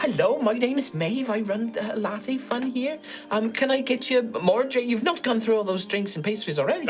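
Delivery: high, fluttery, flustered voice slipping in and out of Irish; a nervous high voice that forgot its accent; flustered cafe owner. Acted. The cafe owner Maeve greets the inquisitors, high and flustered.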